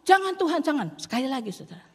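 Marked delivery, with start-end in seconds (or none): 0.1-0.9 desperate plea. Acted. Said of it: "Musa, Harun".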